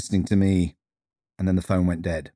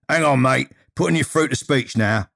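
higher-pitched Cockney switchboard patter; Bosun, broad Cockney accent; 5 semitones higher than usual. Acted. Greeter directing callers on the phone, broad Cockney accent. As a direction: answer as a busy Cockney greeter. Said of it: "with a broad Cockney accent".

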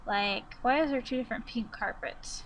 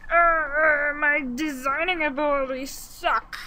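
groaning self-criticism, a bit raised; frustrated groan; 3 semitones higher, 4 dB louder than usual. Real, not acted. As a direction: moan it in exasperation at yourself. Frustrated at own design skills.

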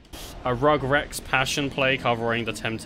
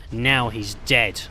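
normal talking voice

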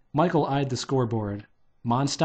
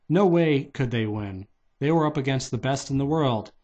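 plain reading voice

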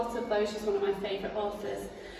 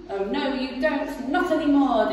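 louder, firm robber voice; declaring rat; 5 semitones higher, 9 dB louder than usual. Acted. Rat refusing the ants, firm and loud. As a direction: shut them down. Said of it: "declared the highway thief".

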